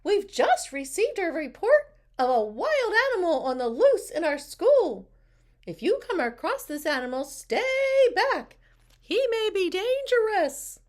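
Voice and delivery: high, loud, emphatic warning; teacher's announcement. A narrator's reading. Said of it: "she announced".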